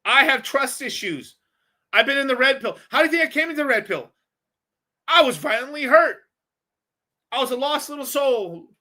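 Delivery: high, hammed-up plaintive voice; mock wounded. Hammed-up confession of being hurt.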